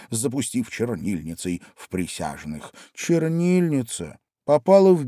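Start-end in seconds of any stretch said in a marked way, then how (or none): none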